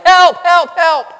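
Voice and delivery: high, loud cries for help; panicked yelling. Retold or imitated. Man in a gorilla suit yelling as the lion pounces, panicked. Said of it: "he starts yelling".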